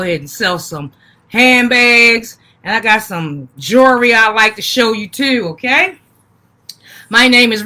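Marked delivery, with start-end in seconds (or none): none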